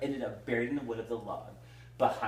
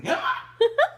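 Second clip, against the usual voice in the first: high hammed-up gasp; a squeaky pretend gasp; mock gasp; 7 dB louder than usual. Acting out the crowd's gasp while reading. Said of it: "Everyone gasped".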